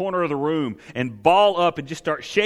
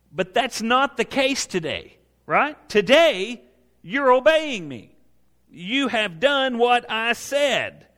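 slow, higher reassuring voice of God; God gently assuring his obedient people; voicing God gently; 5 semitones higher, slower than usual. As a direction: speak as God comforting his people, slowly.

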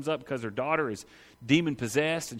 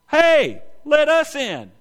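loud, high cry to be let in; pleading shout; 9 semitones higher, 7 dB louder, much slower than usual. Retold or imitated. Outsiders knocking at the kingdom's door, shouting high and loud. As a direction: call through the closed door.

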